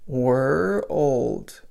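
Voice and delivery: put-on "were old" said nearer together, slight gap; linking "were old" toward world; closer syllables. Shown as a demonstration. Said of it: "Pull them just a little closer".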